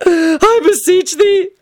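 high, drawn-out weeping prayer; anguished wail. A narrator's reading. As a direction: beseech heaven in slow melodramatic sobs. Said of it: "Tony wailed in anguish".

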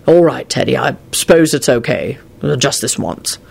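low-pitched, reluctant giving in; sighing. A narrator's reading. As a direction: give in with a guilty sigh. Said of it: "Quentin sighed and felt rather badly".

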